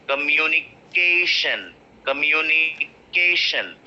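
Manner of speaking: COMMUNICATION slowed, KAY stretched long; stress on KAY. Shown as a demonstration. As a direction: draw out and punch KAY in COMMUNICATION. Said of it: "K is getting stressed".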